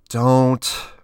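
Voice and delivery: high, loud single word; annoyed at herself. A narrator's reading. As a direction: let it slip out sharply. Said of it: "She sounded annoyed at herself for letting that word out".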